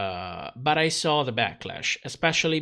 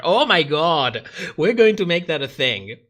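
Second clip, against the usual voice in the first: louder, playful rallying call; excited loud joke; 2 semitones higher, 7 dB louder than usual. Real, not acted. Rallying fans to mock Jan's mother, excited. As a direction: egg the audience on, laughing. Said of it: "joking rally cry".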